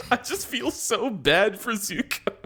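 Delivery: high, slowed, laughing through it; laughing pity. Real, not acted. Fan laughing about Zuko, high and giggly. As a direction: giggle out the sympathy.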